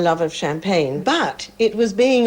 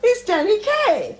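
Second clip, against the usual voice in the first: thrilled; slower than usual. Retold or imitated. Mother thrilled Danny Kaye calls.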